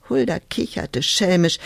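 plain reading voice

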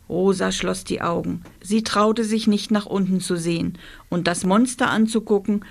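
ordinary delivery

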